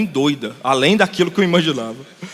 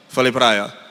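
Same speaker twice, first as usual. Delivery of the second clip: normal talking voice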